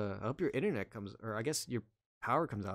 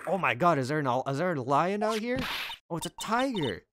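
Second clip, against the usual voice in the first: louder, frightened cry at a lion; panicked shout; 4 semitones higher, 7 dB louder than usual. Acted. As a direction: yell out in alarm.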